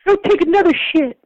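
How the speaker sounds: yelling back at a friend after his rant; angry comeback. Retold or imitated. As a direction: fire it right back at him. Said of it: "when he was done with his tirade, I was like".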